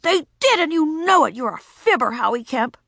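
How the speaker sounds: put-on high, angry child; furious. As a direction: fume at him. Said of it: "burning with fury".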